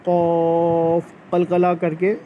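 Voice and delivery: क़ाफ slowed down, with a bouncing q; slow, echoing क़ाफ; qalqala demo. Shown as a demonstration. Teacher demonstrating क़ाफ for children, with a slow qalqala. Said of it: "इसको जो है वो".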